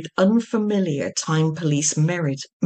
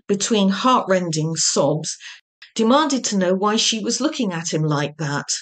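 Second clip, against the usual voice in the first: ordinary delivery, nothing marked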